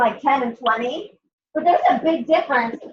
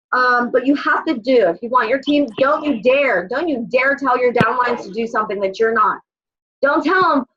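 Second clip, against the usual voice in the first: usual speaking voice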